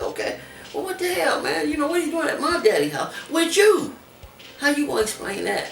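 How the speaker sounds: high retold confrontation; retold anger. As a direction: demand she explain herself. Confronting girlfriend on phone.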